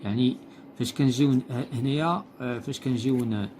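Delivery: ordinary talk, nothing marked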